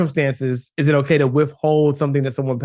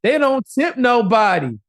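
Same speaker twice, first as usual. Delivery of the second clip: mocking critics; 6 semitones higher than usual. Host imitating critics who would cancel him, mocking accusation. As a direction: imitate scandalized online critics, pitched up.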